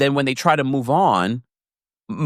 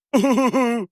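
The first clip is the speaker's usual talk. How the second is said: high mock laugh; 10 semitones higher than usual.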